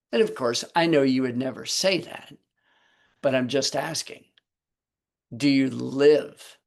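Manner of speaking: ordinary talk, nothing marked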